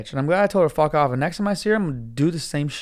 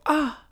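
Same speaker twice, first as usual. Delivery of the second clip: high-pitched mock gasp of disbelief; put-on scandalized little gasp; imitated offended gasp; 11 semitones higher than usual. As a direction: mimic an affronted 'ah'. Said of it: "she made that noise".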